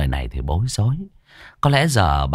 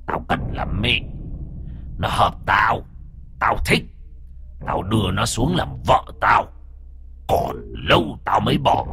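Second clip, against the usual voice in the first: hissing ghost voice. Acted. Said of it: "rít lên".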